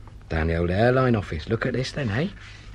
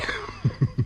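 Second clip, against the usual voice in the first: breathy chuckle; 6 semitones higher than usual. Acted. Laughs after joke.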